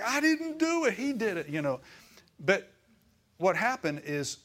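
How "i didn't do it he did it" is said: put-on much higher whiny kid voice; high mock schoolkid protest; childish protest. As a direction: squeal it like a kid denying blame. Kids bickering in line.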